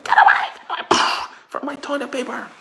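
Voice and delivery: high, loud mock shriek; screaming shopper. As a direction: shriek to guard the toilet paper. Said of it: "they're screaming and shouting".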